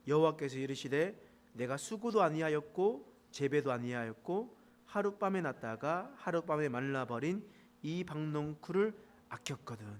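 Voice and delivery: usual speaking voice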